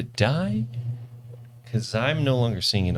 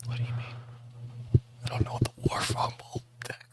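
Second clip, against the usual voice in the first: whispering; 7 semitones higher, 11 dB quieter than usual. Real, not acted. Whispering aside at table.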